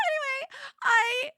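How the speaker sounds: high, loud squeak; flustered squeal. Real, not acted. Streamer trying to get back on topic, flustered squeal. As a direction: try to resume in a strangled squeal. Flustered, failing to resume talk.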